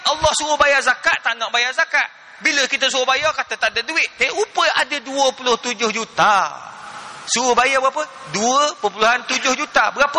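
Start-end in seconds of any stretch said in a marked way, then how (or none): none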